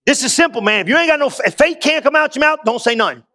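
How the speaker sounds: usual speaking voice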